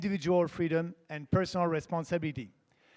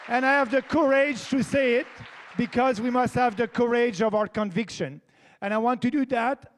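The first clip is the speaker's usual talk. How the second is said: rousing; 5 semitones higher, 5 dB louder than usual. Real, not acted. Rousing climax of stump speech.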